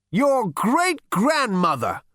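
put-on high, slow, loud elderly echo; musing old man. A narrator's reading. Ancestor repeats Zaphod's words, musing high and slow. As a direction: muse on it slowly in an old voice. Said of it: "mused the gaunt little figure to himself".

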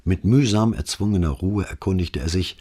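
ordinary delivery

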